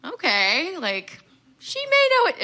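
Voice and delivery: a jump up in pitch, mock impressed; impressed friends. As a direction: friends eyeing the celebrity judges, high amazed squeal. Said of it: "they'd be like".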